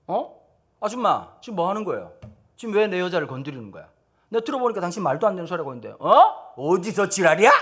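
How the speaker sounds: loud, heated telling-off of the neighbor; angry husband. Pretend. Husband yelling at the upstairs woman, loud and angry. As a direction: snap at her to defend your wife.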